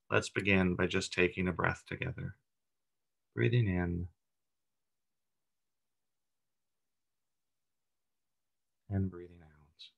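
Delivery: calm meditation leader, long pauses between phrases; guided meditation. Acted. Leader guiding a group through breathing and body relaxation, meditative. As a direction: guide them gently into relaxation, unhurried, leaving room to breathe.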